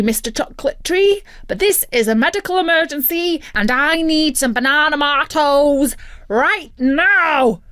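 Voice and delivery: a greedy bird making excuses; mock-serious hungry turkey; whiny turkey excuse. Turkey talks himself into eating.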